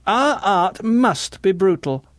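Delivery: high, slow proclamation; high-pitched, drawn-out decree; zealous nurse. A narrator's reading. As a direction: declare it slowly as doctrine.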